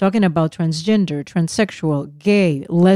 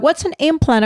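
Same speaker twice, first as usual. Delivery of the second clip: put-on higher child voice asking; imitating the daughter; 9 semitones higher than usual. Mom mimics daughter's question to husband, higher voice. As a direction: mimic the curious daughter, pitched up. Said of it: "And she's like".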